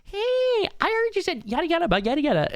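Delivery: high, quick mocking voice of nosy chatters; pestering chatters. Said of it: "little shitters are gonna come in and go".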